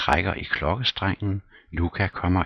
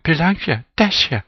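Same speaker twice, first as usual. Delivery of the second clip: very high, loud calling across the house; shrill shouting of names; 13 semitones higher, 9 dB louder than usual. Acted. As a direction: yell for the maids. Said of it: "Råber".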